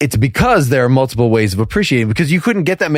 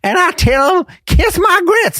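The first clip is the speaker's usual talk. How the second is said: Flo voice. Acted. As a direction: play a sassy Southern waitress, pitched way up. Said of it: "people don't wanna hear that voice".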